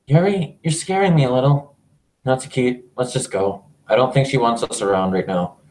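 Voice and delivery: lightly voiced obsessive girl, creepy and intense; a hint of a possessive girl confessing; Yuri, obsessive and unhinged. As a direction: read Yuri as a lovesick yandere. Voices Yuri in visual novel.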